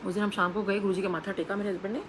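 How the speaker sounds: normal talking voice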